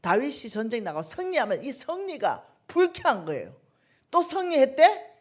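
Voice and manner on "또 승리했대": high, loud, slow resentful question; jealous irritation. Retold or imitated. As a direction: spit out the jealousy. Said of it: "이렇게 되는 거예요".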